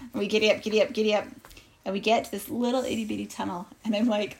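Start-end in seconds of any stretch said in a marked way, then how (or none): none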